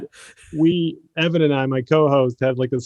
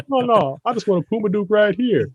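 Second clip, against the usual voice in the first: Barkley insisting, higher; 7 semitones higher than usual. Retold or imitated. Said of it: "Barkley answering clerk".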